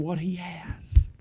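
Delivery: hushed whisper for "what he has". Shown as a demonstration. Preacher drawing the congregation close, whispering. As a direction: breathe the line, no voice. Whispered line before repeat.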